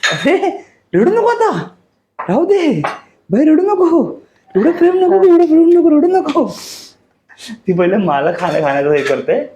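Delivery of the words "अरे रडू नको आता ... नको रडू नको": high, squeaky pretend crying voice; mock baby voice. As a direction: tease her in a squeaky baby voice. Teasing wife, mock baby talk. (चिडवत बारीक बाळासारखा आवाज)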